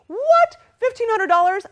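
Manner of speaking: very high, loud squeal of surprise; floored by the amount; astonished. Real, not acted. Host reading Gregory's big gift, astonished.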